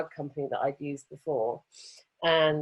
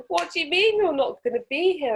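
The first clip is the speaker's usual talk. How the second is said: shocked question; 10 semitones higher, 5 dB louder than usual. Retold or imitated. Rug man's alarmed question.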